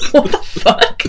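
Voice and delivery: laughing disbelief. Retold or imitated. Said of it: "was like".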